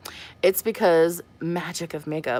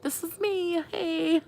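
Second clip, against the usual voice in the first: much higher, soft, sheepish; sheepish admission; 11 semitones higher, 5 dB quieter, slower than usual. Retold or imitated. Caught without makeup by a fan, sheepish and soft. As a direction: own it awkwardly in sweatpants. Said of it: "I was like".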